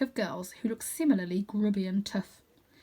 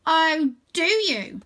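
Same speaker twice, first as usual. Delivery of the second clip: sarcastic; 8 semitones higher, 6 dB louder, much slower than usual. Acted. Sarcastic leader.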